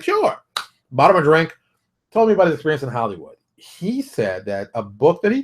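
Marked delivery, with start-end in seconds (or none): 0.0-0.4 eager yes. Retold or imitated. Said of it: "He's like".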